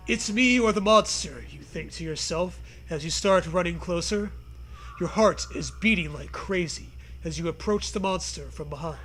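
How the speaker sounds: normal talking voice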